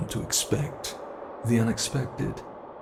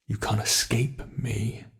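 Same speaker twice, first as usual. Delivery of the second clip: usual speaking voice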